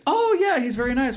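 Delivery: mock-innocent mom; mom's voice. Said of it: "And she's like".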